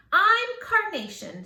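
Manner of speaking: high, loud, eager; eager claim. Acted. Rita eagerly claiming a flower name, high and loud. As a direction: blurt it out proudly.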